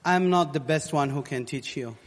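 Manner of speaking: normal talking voice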